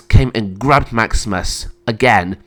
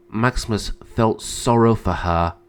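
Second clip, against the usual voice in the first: plain reading voice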